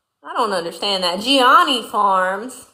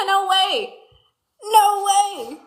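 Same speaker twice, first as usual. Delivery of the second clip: surprised delight; 9 semitones higher, much slower than usual. Real, not acted. Viewer shares her hometown.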